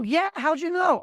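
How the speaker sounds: acted high-pitched amazement; pleasantly surprised. Stranger from Chicago answering a lucky guess, surprised. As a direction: react like a stranger whose hometown was just guessed.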